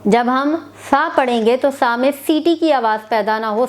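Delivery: ordinary talk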